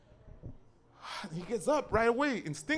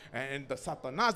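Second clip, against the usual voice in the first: ordinary delivery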